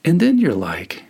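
usual speaking voice

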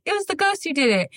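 put-on high child voice, hushed; high, innocent little-girl voice; kid blaming the ghost.

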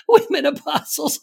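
high, loud imitation of giggly disbelief; mock incredulous laughing. Host mimics the laughing podcast guests, mock giggly disbelief. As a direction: mimic someone unable to say it without laughing. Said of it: "She can't even say it without laughing".

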